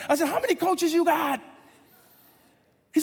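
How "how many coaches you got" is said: high-pitched indignant challenge; mock indignation. Coach challenges player over who coaches him, mock indignation. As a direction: act out an exasperated coach.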